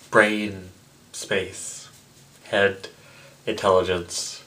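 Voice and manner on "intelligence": ordinary delivery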